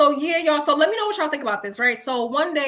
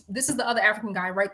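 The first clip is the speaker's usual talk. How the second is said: ordinary delivery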